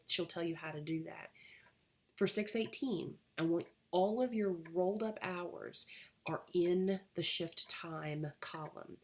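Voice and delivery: ordinary talk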